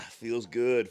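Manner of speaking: high, loud feels good; loud elated. Real, not acted. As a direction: shout the relief. Relief after exertion.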